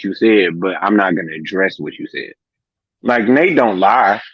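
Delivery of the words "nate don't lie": ordinary talk, nothing marked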